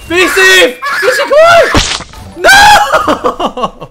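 high, very loud mock-panicked calls; hammed-up desperate shouting. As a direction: scream after a falling friend, overdramatic. Calling to player falling without parachute.

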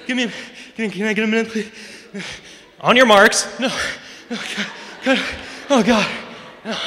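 gasping, wheezy plea before the race; acted winded panic between heavy breaths. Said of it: "when you're out of breath before you make it".

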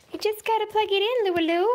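high, loud, fast sing-song to the pet; talking to a dog. Real, not acted. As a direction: chirp it brightly at the dog. Repeats line to the dog.

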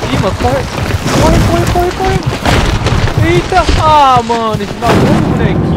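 high, loud panicked yelling; excited shouting. Real, not acted. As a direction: yell at the screen in panic. Chased in game, yelling.

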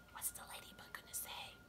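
hushed aside to listeners; whisper. Real, not acted. Asks children in aside.

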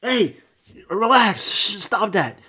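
high, protesting dog voice; startled pet backing off; alarmed dog. Acted. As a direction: play a dog begging for calm. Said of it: "dog's like".

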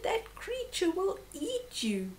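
toned-down high screech for listeners; retold bird warning. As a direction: repeat the bird's warning high, without full force. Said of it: "He said".

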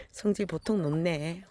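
normal talking voice

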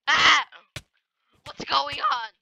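ordinary talk, nothing marked

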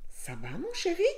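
lightly voiced high wolf wife; wife's concern. A narrator's reading. Madame Loup asks her husband how he is, lightly acted concern. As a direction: pitch rising into a high question.

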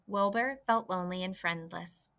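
ordinary delivery

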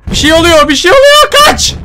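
very high loud squeal of fear; frantic panic. Real, not acted. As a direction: scream it out in full panic. Monster appears, panic. (çok tiz, panik dolu bağırış)

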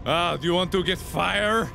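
ordinary talk

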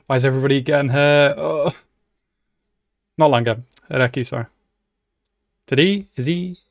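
ordinary delivery

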